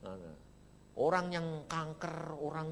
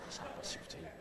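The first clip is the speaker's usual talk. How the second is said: soft unvoiced laughing aside; whispered laughing aside; 10 semitones lower, 10 dB quieter, faster than usual. Real, not acted. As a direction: whisper it to yourself, amused. (bisik sambil tertawa)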